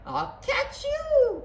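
very high, drawn-out call; squealed falsetto cry. A narrator's reading. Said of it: "cried Nellie".

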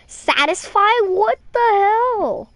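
high, loud, drawn-out disbelief; incredulous outburst. Real, not acted. Streamer repeating the last word of an absurd message, incredulous. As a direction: stretch each word into an appalled cry.

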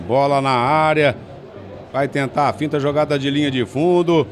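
ordinary talk

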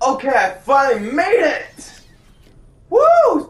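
high, loud joyful whoop; victory cheer. Real, not acted. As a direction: shout for joy.

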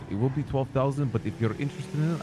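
ordinary talk

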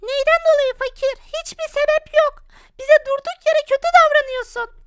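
put-on high, childish plea to dad; squeaky kid asking why. Child pleading with father, high childish voice.

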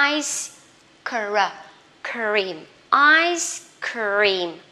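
ice cream in slow parts, ice ending in a hiss. Shown as a demonstration. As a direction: stretch "ice", then build "cre-, cream". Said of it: "C với I thì các bạn xì ra nhá". (ice cream tách chậm, xì âm cuối chữ ice)

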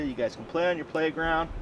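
usual speaking voice

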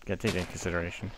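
fast, mumbled throwaway line; quick muttered aside; rushed aside. Real, not acted. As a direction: rattle it off quickly.

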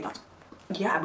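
ordinary talk

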